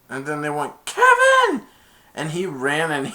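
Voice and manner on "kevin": high, loud call of a name; shrieking Kevin at the top of the voice; yelled name. Retold or imitated. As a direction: holler his name across the yard. Calling out a name.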